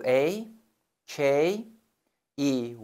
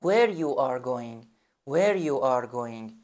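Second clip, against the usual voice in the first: slow Where you are going; slow teacher dictation; slow model sentence; much slower than usual. Shown as a demonstration. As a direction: dictate word by word. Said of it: "میندازید قبل جمله".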